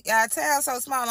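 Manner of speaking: ordinary delivery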